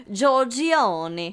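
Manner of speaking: drawn-out, hammed-up Italian surname; mock Italian accent. Woman teasing about his Italian roots, mock Italian accent. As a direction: stretch it out in playful Italian.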